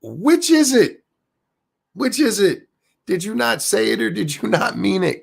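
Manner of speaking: high-pitched, incredulous demand; high, indignant cross-examination; exasperated challenge. Acted. Challenging the absent host's contradiction.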